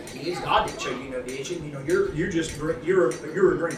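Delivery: devil's voice. Retold or imitated. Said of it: "The devil's on his shoulder".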